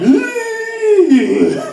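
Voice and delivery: long high-pitched mock "eee"; high comic squeal. Man mocking the deputy's threat, high squeal. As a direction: let out a drawn-out high squeal.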